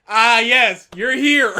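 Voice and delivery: low, loud, solemn note voice; mock grand greeting. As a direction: intone it like a mysterious message. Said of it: "a note that goes".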